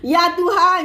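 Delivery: loud, dramatic "oh Lord"; borrowed catchphrase exclamation. Shown as a demonstration. Quoting a teacher's phrase for followers, exclaiming. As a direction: belt it out in showy amazement. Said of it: "Ha, gitu".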